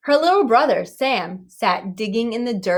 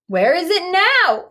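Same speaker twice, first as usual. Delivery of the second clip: anxious; 10 semitones higher, 6 dB louder than usual. A narrator's reading. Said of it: "asked Stella anxiously".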